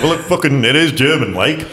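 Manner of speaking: high-pitched comic disbelief; exasperated retort. Retold or imitated. Visitor answering the Bavarian about dialect, mock exasperation. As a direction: protest the obvious, pitch jumping up.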